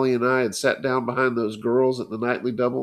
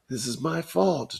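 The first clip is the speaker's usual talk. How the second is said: miserable voice; 5 semitones higher than usual. A narrator's reading. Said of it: "in a miserable voice".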